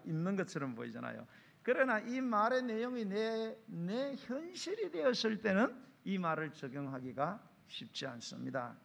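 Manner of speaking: ordinary delivery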